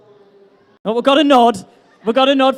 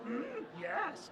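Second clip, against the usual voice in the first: very quiet demo of a creature voice; soft put-on hum and yes; Yoda; 24 dB quieter than usual. Shown as a demonstration. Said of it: "Just go".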